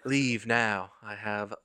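slow, swooning Southern belle; faint like a fragile Southern lady. Pretend.

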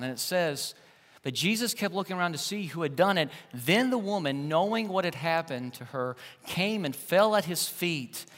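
usual speaking voice

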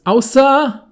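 loud, high exclamation. Pretend. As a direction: blurt it out loudly. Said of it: "dann wird er richtig laut bei diesem Außer".

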